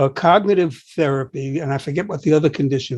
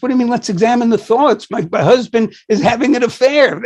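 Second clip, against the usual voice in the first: mock indignant patient; 6 semitones higher, 3 dB louder than usual. Upset patient quoted.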